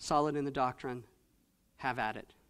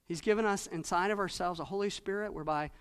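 ordinary talk, nothing marked

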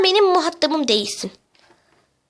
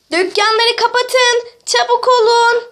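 high, stretched-out alarm call; slow, high panicked command; urgent called orders; 6 semitones higher, 4 dB louder, slower than usual. Acted. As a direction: shout warnings down the street. Calling orders in earthquake panic.

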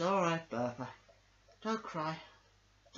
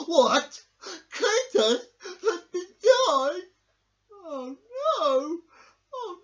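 loud, high, slow wailing grief; hammed-up sobbing; 11 dB louder, much slower than usual. Dog wailing over his dead friend, hammed-up. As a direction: howl with exaggerated grief.

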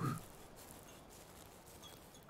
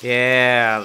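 very loud triumphant yeah; loud cheer; 13 dB louder than usual. Real, not acted. Player cheers as he escapes, loud yeah. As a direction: shout it in relief.

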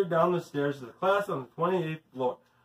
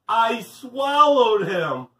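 loud, high and very slow; drawn-out confession; 5 semitones higher, 8 dB louder, much slower than usual. A narrator's reading. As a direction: stretch it out for a big reveal. Boy confesses swallowing the fish.

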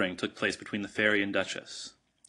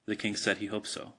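ordinary delivery, nothing marked

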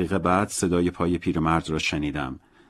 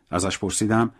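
ordinary delivery

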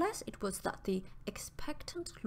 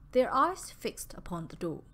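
ordinary delivery, nothing marked

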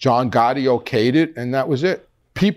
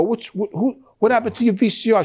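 retold, higher and fast in alarm; alarmed question; 9 semitones higher than usual. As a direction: blurt it out startled. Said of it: "I would go".

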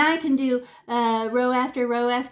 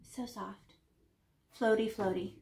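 much softer, floating delivery; 2 semitones lower, 8 dB quieter than usual. Shown as a demonstration. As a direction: let the words float softly. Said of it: "And how soft are we?".

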